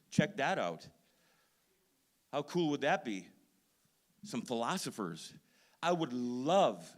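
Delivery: loud, ringing pulpit delivery; full-force preaching. Acted. Pastor fires up the congregation on Paul, preaching loud. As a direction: preach it out to the whole room.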